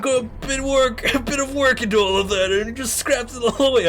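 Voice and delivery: put-on high, loud ranting old man. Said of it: "no more old man voice".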